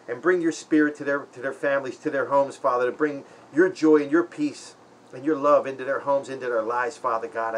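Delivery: an earnest spoken prayer of blessing; heartfelt prayer. Real, not acted. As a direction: pray quietly and sincerely for friends. Prays for the senders.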